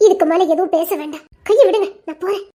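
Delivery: low, firm and serious. Acted. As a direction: drop the joking and mean it. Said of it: "சீரியஸா தான் சொல்றேன்".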